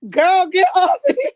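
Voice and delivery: high, loud, laughing protest; real giggling, cracking up. Real, not acted. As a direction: shriek it through laughter.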